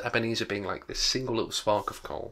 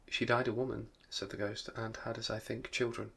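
quiet ghost voice; 8 dB quieter than usual. A narrator's reading.

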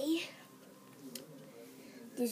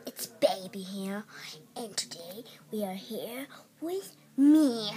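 whispering; 2 semitones lower than usual. Real, not acted. Whispered intro vlog.

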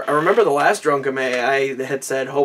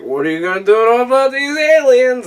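mock movie official; 8 semitones higher, 6 dB louder than usual. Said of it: "they're like".

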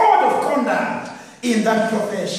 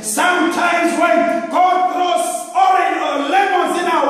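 higher, shouting preacher's roar; full-force preaching; 4 semitones higher than usual. Acted. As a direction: thunder the promise out over the crowd.

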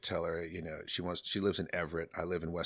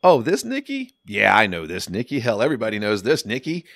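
1 loud excited greeting; 3 semitones higher, 11 dB louder than usual. Retold or imitated. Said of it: "and I hear".

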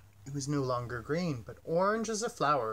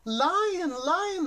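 very high, bright delighted call; delighted chirp; 13 semitones higher, 5 dB louder than usual. A narrator's reading. Bird sees the painted cave walls, calling out high and delighted. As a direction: squeal his name with delight. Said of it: "the bird chirped, delighted".

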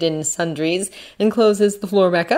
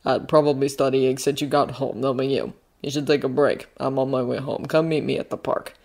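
low, soft character voice; Bucky, casual guardian; 5 semitones lower, 5 dB quieter than usual. Acted. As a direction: speak low and easy, checking in on the kid.

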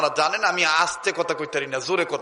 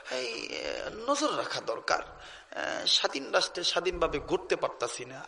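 mocking talk-show voice; 9 dB quieter, slower than usual. Mimicking TV talk-show intellectuals.

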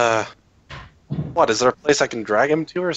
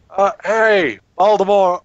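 calling out; 5 semitones higher, 5 dB louder, slower than usual. Acted. Calling out to a comrade.

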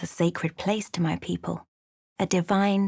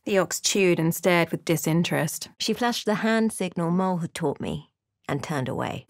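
ordinary talk, nothing marked

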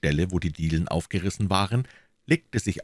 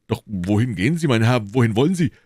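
alarmed servant; 4 semitones higher, 5 dB louder than usual. A narrator's reading.

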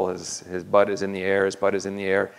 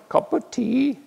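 high squeaky British voice, rising at the end; British accent; slower than usual. Retold or imitated. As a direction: chirp it like a posh little Brit. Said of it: "it's British voices going".

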